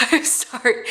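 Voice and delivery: laughing through tears, a little embarrassed; teary laugh. Real, not acted. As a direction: laugh off the tears as you apologise. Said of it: "now that I'm, like, crying".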